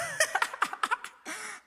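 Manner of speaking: sudden high burst of laughter; real amused laugh. Real, not acted. Host laughs at contestants.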